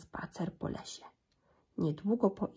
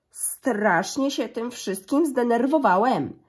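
slow, louder grumbling papa bear; gruby zrzędliwy głos; 4 semitones higher, 6 dB louder, slower than usual. A narrator's reading. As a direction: grumble it out slowly, annoyed. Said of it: "grubym, zrzędliwym głosem". (tata niedźwiedź zrzędzi wolno i głośniej)

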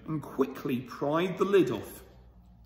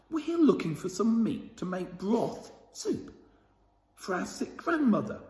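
put-on child's voice; 6 semitones higher than usual. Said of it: "said Estelle".

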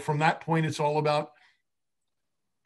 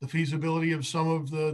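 ordinary talk, nothing marked

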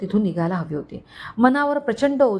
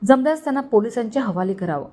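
ordinary delivery, nothing marked

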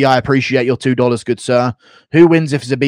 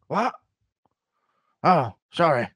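dazed Bisping; 3 semitones higher, 4 dB quieter than usual. Retold or imitated. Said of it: "Bisping with Alzheimer's impression".

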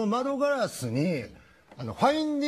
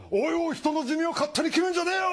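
mock indignant turtle; 7 semitones higher, faster than usual. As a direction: snap back in mock offence. Turtle retort.

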